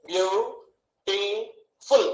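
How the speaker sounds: బ్యూటిఫుల్ slowly split as "బ్యూ... టి... ఫుల్"; long pauses between బ్యూ, టి and ఫుల్; three syllables. Shown as a demonstration. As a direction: break బ్యూటిఫుల్ into three slow beats. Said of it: "ఎన్ని ముక్కలు కొట్టాం".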